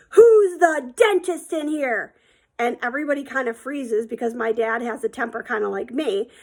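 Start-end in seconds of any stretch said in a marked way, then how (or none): 0.1-2.1 slow, high, loud demand; angry dad yelling. Retold or imitated. Dad storming into the bar, yelling. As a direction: bellow it across the room, stretching each word. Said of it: "he walks in and he yells".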